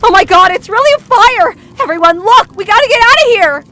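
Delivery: loud, high-pitched panicked scream. A narrator's reading. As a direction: scream out a fire alarm in panic. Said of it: "As she screamed".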